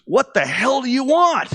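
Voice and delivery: high, loud, slow exasperated shout; loud exasperated demand; frustrated yell. Retold or imitated. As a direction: bark it out like a fed-up mentor. Bob's frustrated outburst retold.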